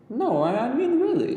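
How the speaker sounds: put-on young girl pleading to be told; persistent girl. A narrator's reading. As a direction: press the question like a stubborn girl. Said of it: "she persisted".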